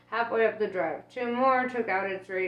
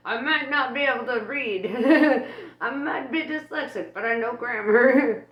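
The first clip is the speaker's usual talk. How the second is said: laughing joke at herself, louder and higher; 4 semitones higher, 6 dB louder than usual. Real, not acted. Reader joking about being dyslexic, laughing loudly. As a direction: laugh through a self-mocking brag. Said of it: "joking about own dyslexia".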